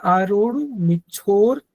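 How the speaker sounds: slow "आरोड़ मिच्छोर" with a half "र" and a break before "मिच्छोर"; half r, word split. Shown as a demonstration. Teacher modelling the split for students, slow and deliberate. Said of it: "र को आधा लेंगे".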